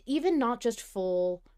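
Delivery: usual speaking voice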